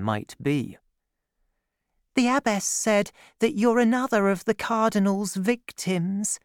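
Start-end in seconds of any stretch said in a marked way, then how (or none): 2.1-6.4 put-on high, gentle girlish voice; young woman. A narrator's reading. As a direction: play Constance, shy and kind. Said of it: "continued milady's visitor".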